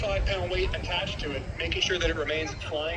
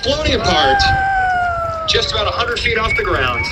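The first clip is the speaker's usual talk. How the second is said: airshow commentator; 3 semitones higher, 13 dB louder than usual. Acted. Live airshow PA announcing.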